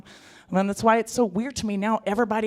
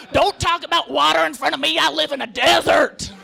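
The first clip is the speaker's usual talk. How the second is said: angry sandworm yell; 7 semitones higher, 7 dB louder than usual. Pretend.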